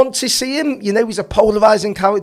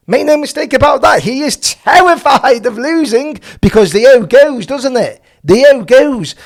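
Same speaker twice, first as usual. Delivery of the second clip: emphatic raised voice; 4 semitones higher, 5 dB louder than usual. Real, not acted. Heated point about fear of losing.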